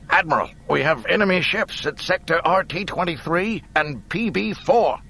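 high, loud report; calling out. Acted. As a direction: call across the bridge. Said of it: "called out to Akbar".

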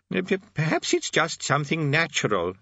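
put-on vague, wandering old man; old wizard voice. A narrator's reading. Old Arch-Chancellor speaks.

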